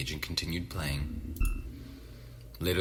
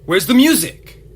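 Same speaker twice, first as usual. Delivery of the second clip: panicked shout; 11 semitones higher, 15 dB louder than usual. Real, not acted. Second, louder repeat.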